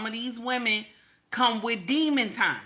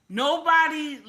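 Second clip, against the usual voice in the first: ordinary talk, nothing marked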